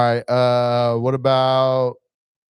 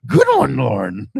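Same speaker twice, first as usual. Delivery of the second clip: amused praise; slower than usual. Real, not acted. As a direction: crack up while congratulating. Host laughing at co-host's attempt.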